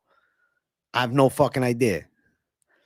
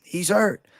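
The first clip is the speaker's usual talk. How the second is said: higher commentator "He's hurt" with a rise; excited cageside call; lightly done Joe Rogan; Rogan calling a fight; 6 semitones higher than usual. Retold or imitated. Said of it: "Do Rogan and say he's hurt".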